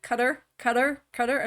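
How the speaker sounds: American "Qatar", like "cutter"; American-accented "Qatar", stressed up front; imitated American newsreaders. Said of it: "people were going".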